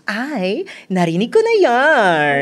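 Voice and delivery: put-on feminine on-air greeting, starting very high; female radio DJ voice. Shown as a demonstration. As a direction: switch into a high-pitched woman's radio persona, opening at full volume. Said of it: "So, pwede ba ako mag-intro?".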